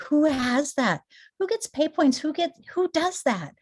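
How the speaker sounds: high-pitched amazed rhetorical questions; excited disbelief. Real, not acted. An excited rep asking her viewers, high and amazed.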